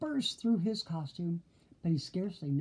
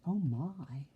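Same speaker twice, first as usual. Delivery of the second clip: ordinary delivery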